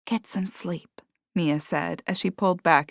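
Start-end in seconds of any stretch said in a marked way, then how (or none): 0.1-1.0 whispered goodnight; soft whisper. A narrator's reading. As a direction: breathe it gently, no voice. Mia comforting grieving Ellie softly.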